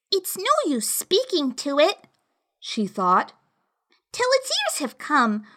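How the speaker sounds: put-on high, thoughtful little girl; girl musing to herself; young girl voice. A narrator's reading.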